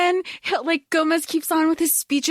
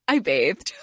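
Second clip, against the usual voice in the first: much lower voice, mock proud; awkward suitor impression; 11 semitones lower than usual. Fester answering Debbie's flirting, low comic voice. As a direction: drop low for a comic man's reply.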